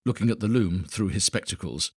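usual speaking voice

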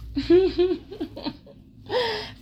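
high giggle trailing off; cheeky high giggle; laugh. Real, not acted. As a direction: giggle at your own joke. Laughs at own joke.

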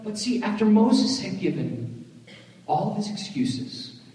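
normal talking voice

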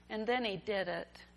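ordinary talk, nothing marked

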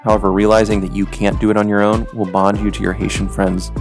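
usual speaking voice

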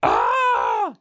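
deep, loud imitated death scream; a falling death yell, low and loud; death cry. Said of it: "it also has a great death scream if you fall".

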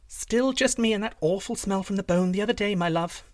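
high, innocent princess voice; sweet princess. Acted.

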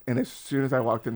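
ordinary delivery

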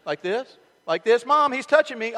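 provoke, then tattle loudly; teasing little brother. Retold or imitated. Kids teasing and tattling in car.

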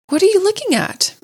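high-pitched, puzzled query; curious question. A narrator's reading. Rat asks mole whose eyes wandered, curious question. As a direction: ask with voice swooping right up.

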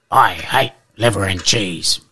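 lightly voiced big dog; a hint of a blunt Boxer dog. Boxer answers the poodle.

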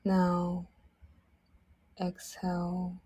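ordinary talk

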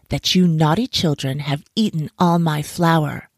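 usual speaking voice

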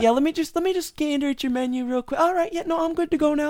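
high, overly nice customer voice; customer service voice. Retold or imitated. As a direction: sweeten it way up like a polite customer. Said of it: "it's like their customer service voice".

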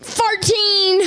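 surprised shout. Real, not acted. Repeating age in surprise.

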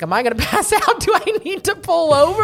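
high, loud, half-laughing mock panic; imitating her own panic with a laugh; retold panic.

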